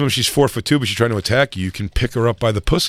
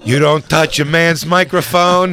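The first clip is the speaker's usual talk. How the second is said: loud, higher, slow proclamation; mock outrage; 5 semitones higher, 5 dB louder, slower than usual. Comic scolding whoever grabbed the microphone, loud mock outrage. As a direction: lay down the law in mock indignation. Acting outrage at the mic grab.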